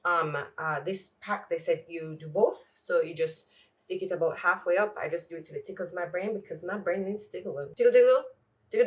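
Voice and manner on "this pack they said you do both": usual speaking voice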